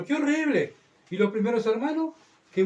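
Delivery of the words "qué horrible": high-pitched cry of mock dismay; hammed-up horror, pitched way up; mock horrified complaint. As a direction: exclaim like a whining believer. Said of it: "escucho a los cristianos decir".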